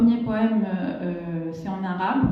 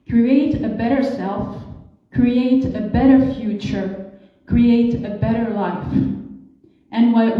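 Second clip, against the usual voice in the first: emphatic English verse declamation, stressed key words; exhorting poetry reading; poem recital; 6 dB louder than usual. Acted. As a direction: recite it deliberately, punching key words, with pauses. Reads own English poem aloud.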